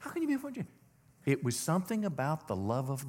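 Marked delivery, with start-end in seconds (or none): 0.0-0.7 high soft amazed question; incredulous. Shown as a demonstration. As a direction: ask it in wonder, way up high. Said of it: "scratch your head a second".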